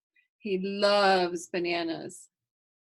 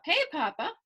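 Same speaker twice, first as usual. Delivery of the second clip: joyful call; 8 semitones higher than usual. A narrator's reading. Kylie running to Papa at the bus stop, glad mock shout. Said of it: "I shout with joy".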